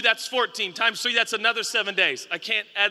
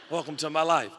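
laughing aside; 5 semitones lower, 4 dB quieter than usual. Real, not acted. Laughing self-deprecating aside.

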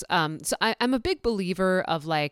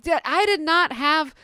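flustered denial; 7 semitones higher, 6 dB louder than usual. Retold or imitated. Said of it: "I'm like".

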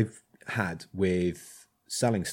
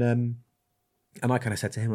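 normal talking voice

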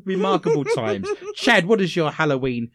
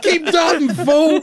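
higher, louder laughing yell; mock shout; 11 semitones higher, 7 dB louder than usual. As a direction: yell it across the bingo hall. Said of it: "What did you shout out to Michelle".

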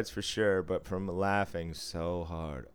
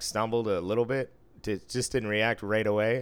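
ordinary talk

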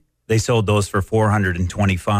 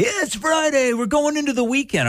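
much higher, peppy DJ voice; radio jock parody. Retold or imitated. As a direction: hype it up like a cheesy radio host. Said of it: "radio jocks would say".

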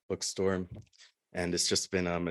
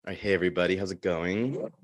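ordinary delivery

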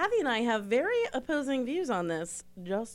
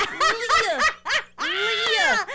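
Leah called out much higher and louder with a put-on accent; mock accented call; 7 dB louder than usual. Shown as a demonstration. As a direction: shout Leah in a hammed-up accent. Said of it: "you said it with an accent".

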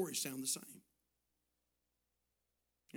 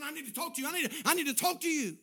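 high-pitched urgent plea, growing louder; frantic; 8 semitones higher, 5 dB louder, faster than usual. Retold or imitated. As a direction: stammer it out like a desperate man. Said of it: "He comes up to me frantic".

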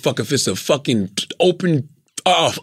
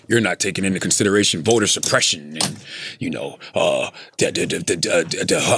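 low, droning imitation of objectors; mock critics; 12 semitones lower, 4 dB quieter than usual. Guest mimicking people criticizing [name], bored low drone. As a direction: drop low and trail into dismissive "da, da, da". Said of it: "They're like".

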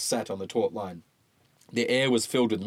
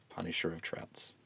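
ordinary delivery

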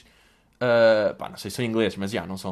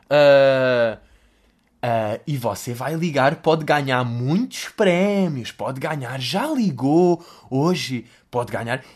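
slow, drawn-out fake TV host voice; TV presenter parody; much slower than usual. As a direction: sell the prize line like a phone-in show host. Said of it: "A linguagem de televisão desse tipo de pessoas é tipo". (apresentador de televisão falso e arrastado)

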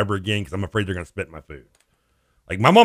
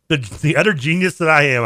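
normal talking voice

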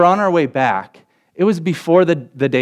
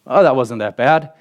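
sleepy, offhand shrug of a voice; shrugging it off; unbothered brother. Retold or imitated. Older brother waking after the crash, offhand and unbothered.

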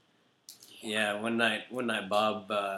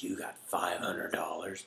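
slow whispered disbelief; awed whisper; 4 semitones lower, 4 dB quieter, slower than usual. Retold or imitated. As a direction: whisper it like it's a fortune.

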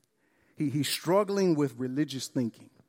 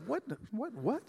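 mock bewilderment; 6 semitones higher, 9 dB quieter than usual. Mock disbelief at elder son.

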